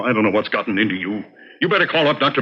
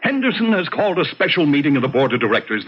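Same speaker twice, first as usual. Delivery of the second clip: ordinary talk, nothing marked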